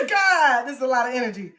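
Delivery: ordinary talk, nothing marked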